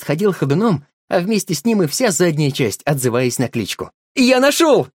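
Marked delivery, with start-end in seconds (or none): 4.2-4.9 high loud cry of joy; joyful shout. A narrator's reading. Boy rejoices at finding the name, joyful shout. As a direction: shout out the triumph. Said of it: "радовался Женька".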